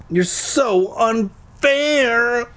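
high, slow whiny wail. A narrator's reading. Boy wailing at Tasha over being unfair, whiny. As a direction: drag it out like a baby. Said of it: "I wailed".

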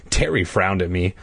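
ordinary delivery, nothing marked

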